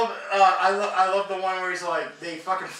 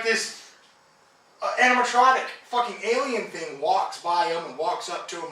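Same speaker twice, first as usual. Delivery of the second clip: ordinary talk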